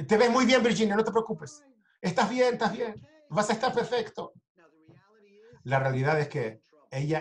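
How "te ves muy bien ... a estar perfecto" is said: reassuring. Retold or imitated. To burned Virginia, keeping her spirits up, bright reassurance. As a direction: cheer her up against the fear.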